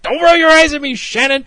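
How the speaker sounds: loud, high scolding shout; retold outburst, snapping at insolence; Kristen's angry rebuke. Boss to eye-rolling worker, angry shout. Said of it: "And Shannon's rolling her eyes".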